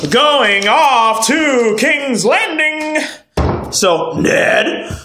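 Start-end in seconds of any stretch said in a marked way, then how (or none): 0.1-3.0 very slow, high, drawn-out call; playful announcement. Pretend. Recapper announcing the journey, playful drawn-out call. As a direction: sing out the destination, stretching each word.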